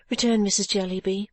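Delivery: ordinary delivery, nothing marked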